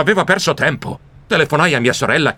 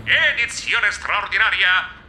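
headline read in a raised voice; news read; 4 semitones higher than usual. Acted. Mouse reading the shocking headline aloud like news. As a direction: read it like an announcer breaking news.

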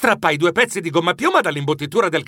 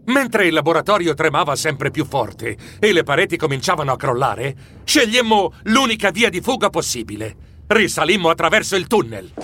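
ordinary delivery, nothing marked

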